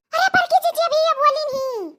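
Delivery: ordinary talk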